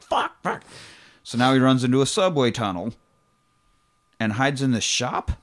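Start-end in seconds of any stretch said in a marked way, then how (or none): 0.0-0.6 high-pitched panicked cursing; mock panic. Fugitive with cops still after him, mock panic. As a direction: blurt it high, as if under fire. Acted panic under police gunfire.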